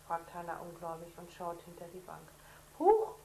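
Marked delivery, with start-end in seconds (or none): 2.8-3.2 very high, loud startled cry; startled huch. Acted. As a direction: jump with fright. Sister startled by elf. (Hannah erschrickt, sehr hoch und laut)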